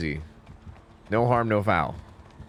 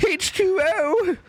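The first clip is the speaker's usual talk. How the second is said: much higher, slow goofy doofus voice; dumb movie voice; much slower than usual. Retold or imitated. Retelling a movie scene to the stream, in a high goofy dumb-guy voice. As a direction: play a dim-witted movie character spelling out his word. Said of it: "And he'd be like".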